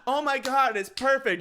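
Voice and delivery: high, loud imitated delight; mock gushing. Imitating the owner praising the dog's pick, loudly gushing. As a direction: gush over it as if thrilled.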